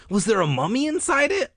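high, loud, excited question; eager. A narrator's reading. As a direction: ask it bursting with eagerness. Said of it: "I asked eagerly".

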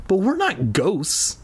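put-on high, slow, uneasy kid; trembling voice. A narrator's reading. Little brother balks at the ghost idea, high trembling voice. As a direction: drag it out high with a mild frightened waver. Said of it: "replied in a trembling voice".

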